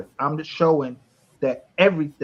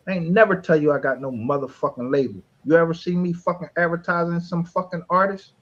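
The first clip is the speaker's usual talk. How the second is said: ordinary talk, nothing marked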